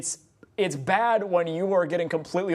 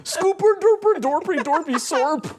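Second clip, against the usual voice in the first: high, put-on nonsense chef babble; Swedish Chef gibberish; 8 semitones higher, 6 dB louder, slower than usual. Mocking a rapper's verse to his co-host, high gibberish chef voice. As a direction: mimic an auto-tuned Swedish Chef, loud and silly. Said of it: "auto-tuned the Swedish Chef on this song".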